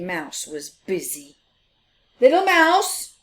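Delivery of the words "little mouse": high, loud call up the ladder. Acted. Big Mouse calling up to Little Mouse, loud call. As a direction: call up to him. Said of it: "he called".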